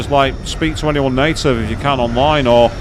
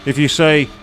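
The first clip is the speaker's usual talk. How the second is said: ordinary delivery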